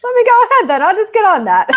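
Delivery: jokey put-on English accent; mock English accent. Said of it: "That's, that was my English accent".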